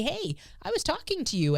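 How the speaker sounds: pointed mock callout. Poke to get attention.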